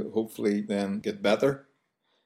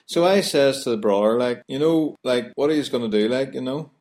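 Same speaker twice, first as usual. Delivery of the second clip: lightly higher Belfast storytelling; Belfast retelling; 3 semitones higher than usual. Shown as a demonstration. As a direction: chat it like a Belfast local. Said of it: "we relate a conversation".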